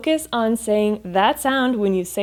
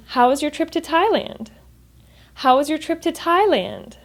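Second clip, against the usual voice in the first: higher pitch, "Thailand" peaking then falling away; falling question intonation; 5 semitones higher than usual. Shown as a demonstration. Teacher modelling a friend's question, falling intonation at the end. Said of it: "There's a falling intonation at the end".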